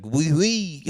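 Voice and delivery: high, loud French caricature; French guy. Acted. French guy answers about his fob, exaggerated French accent. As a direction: put on a comic French accent. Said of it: "a French guy".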